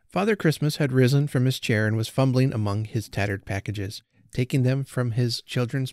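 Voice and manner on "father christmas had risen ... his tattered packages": ordinary delivery, nothing marked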